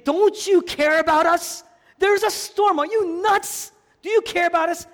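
high, loud, frantic pleading; panicked disciples. Retold or imitated. Disciples yelling at sleeping Jesus in the storm, panicked. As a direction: cry out in alarm over the storm. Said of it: "they turn to Jesus and say".

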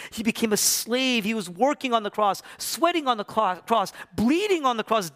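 high, mounting pulpit cry; impassioned, insistent sermon list; full-force preaching. Real, not acted. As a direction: build to a loud climax, drawing out the end.

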